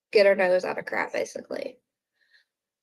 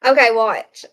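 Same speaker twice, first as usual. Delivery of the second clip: usual speaking voice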